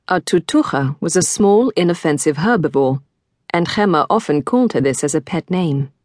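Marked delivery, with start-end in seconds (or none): none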